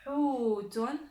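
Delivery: loud, drawn-out model word; lecture appuyée. Shown as a demonstration. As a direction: hold the long u in Hutun. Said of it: "Je vais lire les mots". (lecture forte et appuyée)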